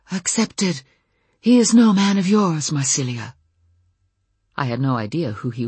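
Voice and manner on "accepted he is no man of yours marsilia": light breathy voice. Acted. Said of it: "in a light, breathy voice he said".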